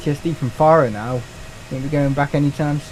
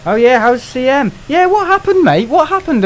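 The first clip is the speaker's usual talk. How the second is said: excited; 10 semitones higher, 6 dB louder than usual. Real, not acted. Eager greeting to a returning viewer.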